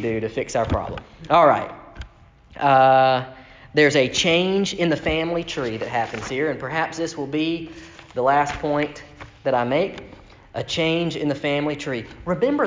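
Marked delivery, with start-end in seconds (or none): none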